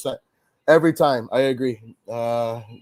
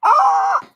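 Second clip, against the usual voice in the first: short piercing scream of despair; high-pitched scream. Real, not acted. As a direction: shriek very high in mock agony. Laughing despair at a blowout.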